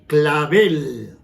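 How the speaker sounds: "clavel" drawn out on a punched -vel; stress on last syllable. Shown as a demonstration. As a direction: hit the end of "clavel": cla-VEL. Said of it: "palabra aguda".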